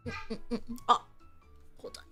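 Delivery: ordinary delivery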